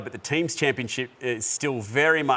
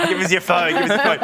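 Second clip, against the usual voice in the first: real laughter through the words, louder; laughing demand; 4 semitones higher, 5 dB louder than usual. Real, not acted. Host jokingly asking the guest for his phone, laughing. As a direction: demand it playfully while cracking up.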